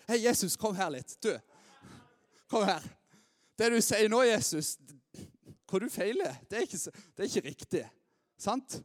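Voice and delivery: loud, cocky know-it-all scolding Jesus; arrogant Peter. Retold or imitated. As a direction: talk down to Jesus, loud and sure of yourself. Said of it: "den arrogante lille køtten". (høylytt, arrogant Peter som irettesetter Jesus)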